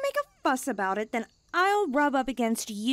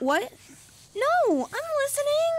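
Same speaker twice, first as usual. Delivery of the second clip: high, flustered insistence she was listening; pitched-up, defensive; flustered denial. Acted. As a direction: caught out, squeak a quick defence. Caught not listening, protests.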